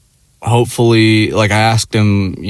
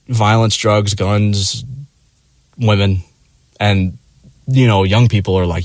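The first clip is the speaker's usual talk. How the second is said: ordinary talk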